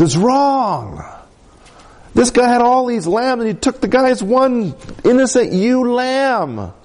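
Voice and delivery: high, indignant outburst as King David; preacher voicing David's outrage at the rich man; fired up. King David, enraged at the stolen lamb, high indignant outcry. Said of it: "And he's fired up. He's enraged".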